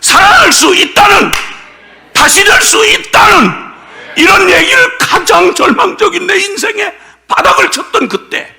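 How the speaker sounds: loud, high impassioned sermon voice, slowing on key phrases; fiery preaching. Acted. Pastor shouting his testimony to the congregation, impassioned. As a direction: pour it out like a revival preacher.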